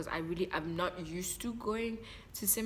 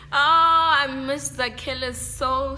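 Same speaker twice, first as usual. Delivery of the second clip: laughing emotional; 8 semitones higher, 9 dB louder, slower than usual. Real, not acted. Emotional over missed friend.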